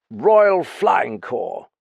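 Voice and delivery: put-on drawn-out pompous drunken reply; slow drunk pronouncement. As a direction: spell it out grandly and slowly, drunk. Drunk Graffin adds.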